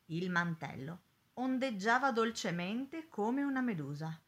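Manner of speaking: ordinary delivery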